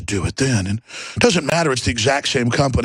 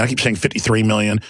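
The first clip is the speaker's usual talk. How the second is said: normal talking voice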